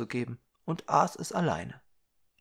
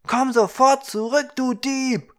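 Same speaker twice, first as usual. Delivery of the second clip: much louder, higher, outraged yell; angry shout; 12 semitones higher, 10 dB louder than usual. Acted. Young man yelling after the thieving dwarf, furious and loud. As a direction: yell after the fleeing thief. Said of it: "wütend und verblüfft um und rief".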